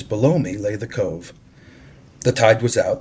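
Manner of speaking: ordinary delivery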